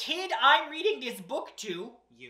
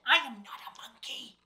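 whispered aside. Real, not acted. Reader protests aside.

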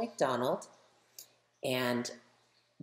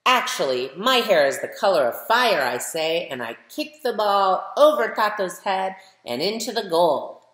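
put-on lively, louder storybook reading for kids; reading to children; 4 semitones higher, 7 dB louder than usual. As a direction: read it like a picture book for a class, brightly. Reads picture book aloud to children.

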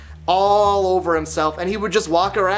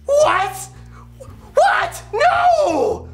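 hammed-up high-pitched cries of horror; outraged old people; much slower than usual. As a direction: squeal in scandalised disbelief like offended elders. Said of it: "watch their faces go".

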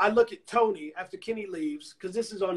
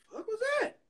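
sudden high baffled squeak; 5 dB quieter, faster than usual. Retold or imitated. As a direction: caught off guard by the click. Baffled after being hung up.

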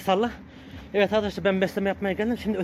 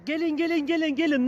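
calling the dogs; 10 semitones higher than usual. Real, not acted. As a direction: call them over in a high voice. Calling stray dogs to food.

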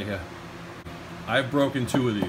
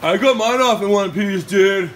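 mock commenter voice; 6 semitones higher, 8 dB louder than usual. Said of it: "someone's gonna comment and go".

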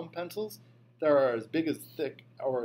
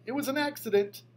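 high, loud pleading cry; a kid hollering that it was an accident; hollered; 11 semitones higher, 3 dB louder than usual. A narrator's reading. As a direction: shout the excuse high and fast. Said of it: "I hollered".

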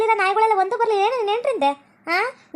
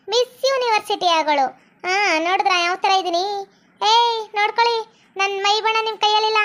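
put-on vain modern girl. Fashionable girl flaunting her dress to older villagers, vain. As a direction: show off to the old folk.

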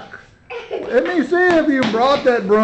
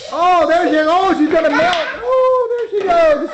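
excited; 7 semitones higher than usual. Real, not acted. Witch melting in kids' play.

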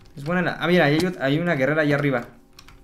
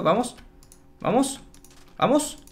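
calling them along; 7 semitones higher, slower than usual. Real, not acted. Urging villagers to follow him.

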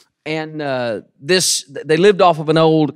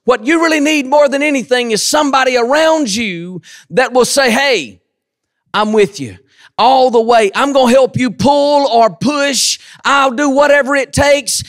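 preaching; 7 semitones higher than usual. Acted. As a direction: build the sermon to a shout. Preaching at full force.

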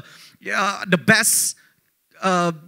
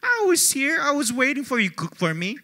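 hurt outburst; 6 semitones higher, 4 dB quieter than usual. Retold or imitated. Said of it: "I said".

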